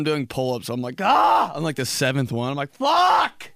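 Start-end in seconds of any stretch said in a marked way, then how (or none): 1.0-1.5 loud, high strained yell; mock strained gym yell. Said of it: "And I yell". 2.7-3.5 loud, high curse mid-rep; mock strained shout; strained yell. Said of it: "And I yell".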